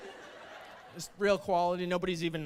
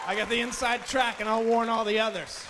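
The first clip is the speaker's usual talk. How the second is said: louder, higher punchline; comic shout; 5 semitones higher, 6 dB louder than usual. Acted. Promising to warn the others, comic shout. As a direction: bark the reassurance to the crowd.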